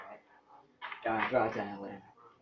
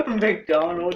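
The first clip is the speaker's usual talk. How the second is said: laughing; 4 semitones higher, 6 dB louder, slower than usual. Real, not acted. Repeats it laughing.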